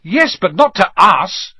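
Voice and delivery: loud and high, at the end of patience; Ron. A narrator's reading. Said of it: "said Ron in exasperation".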